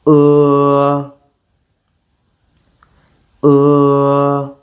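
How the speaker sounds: "Uh" with mouth slightly open, tongue curled back; "Uh" tongue pulled back; Mandarin e vowel. Shown as a demonstration. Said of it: "watch my mouth and repeat after me".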